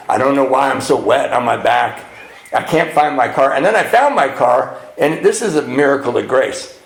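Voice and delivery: normal talking voice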